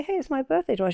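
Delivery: high-pitched, cheery retold reminder; upbeat announcement. Mother reminding forgetful daughter of her birthday, acted upbeat. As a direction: brightly re-enact breaking good news.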